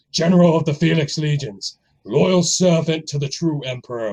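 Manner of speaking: put-on intimidating movie warrior, full-on accent; low, intimidating vow of vengeance in character; Maximus, accented. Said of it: "That was a great accent".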